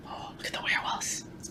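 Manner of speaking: hushed excited whisper. Real, not acted. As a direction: lean in and whisper it. Whisper to children.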